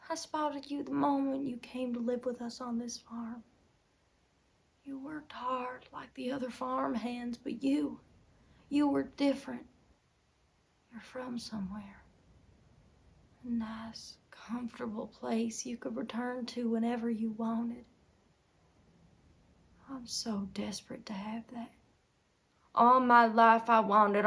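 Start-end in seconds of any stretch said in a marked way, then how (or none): 0.1-7.4 soft, breath-light recollection; hushed reminiscence. Acted. Recalling first sight of husband. 10.9-21.7 very soft, near-whispered yearning; hushed longing. Acted. Admitting longing to escape.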